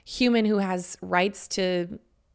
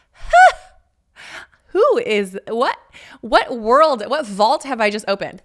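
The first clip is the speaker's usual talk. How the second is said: shocked disbelief; 3 semitones higher, 6 dB louder, slower than usual. Retold or imitated. Shocked reaction to steamy book.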